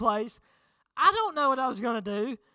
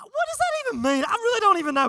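exasperated; 9 semitones higher, 4 dB louder, faster than usual. Real, not acted. Exasperated joke after quote.

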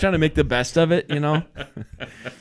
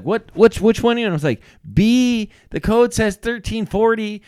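raised voice; 8 semitones higher, 3 dB louder than usual. Retold or imitated. Retold exchange at lockbox.

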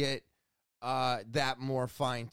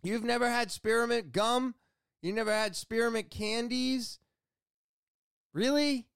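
high-pitched questioning in mock disbelief; each question more amazed, ending on a high "Really?"; incredulous; 9 semitones higher than usual. Shop worker quizzing customer about spearmint gum, incredulous.

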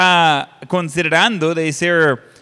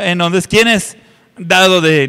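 ordinary talk

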